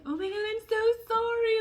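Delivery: high, loud, over-emotional wail; hammed-up weepy apology; mock crying sympathy. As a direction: gush as a tearful therapist would. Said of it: "And they're crying".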